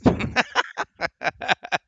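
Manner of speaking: high-pitched real laugh. Real, not acted. Streamer laughs after praising game.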